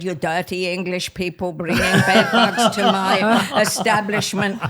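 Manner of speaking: put-on Parisian accent. Paris landlady scolding the English dancers over bed bugs, Parisian accent. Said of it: "That's a very good Parisian accent".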